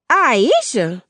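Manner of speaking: high, loud indignant echo of her own name; offended mother. Acted. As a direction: throw the name back at him, insulted. Mother offended at being named.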